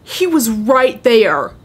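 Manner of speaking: loud, slow, startled cry; alarmed exclamation. Real, not acted. Player spotting the murderer close by, alarmed. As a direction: draw it out loudly in alarm.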